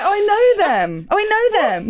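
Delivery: hammed-up thrill at spotting a celebrity; mock recognition. Party guest thinking she knows people, mock delight. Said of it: "I was like".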